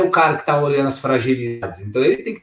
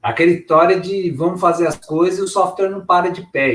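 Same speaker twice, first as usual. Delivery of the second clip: ordinary talk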